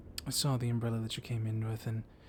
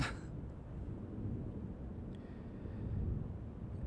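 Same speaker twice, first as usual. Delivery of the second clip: quiet knowing chuckle; soft laugh; 9 dB quieter than usual. Acted. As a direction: laugh softly at your own cheek. Laughs after calling professor hard ass.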